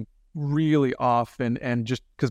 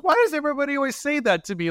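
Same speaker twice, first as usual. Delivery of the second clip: much higher, fast, mock-exasperated whine; exasperated John; 11 semitones higher, much faster than usual. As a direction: play him fed up with hearing it, rushing. John exasperated at common reaction.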